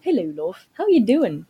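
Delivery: put-on British English greeting; hammed-up British accent. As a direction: greet like a chummy Brit, pitch swooping. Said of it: "mon magnifique accent".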